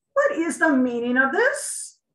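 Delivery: loud, high demand from an angry king; the king roars at the intrusion; outraged royal bellow. Acted. Angry king bursts in on guard.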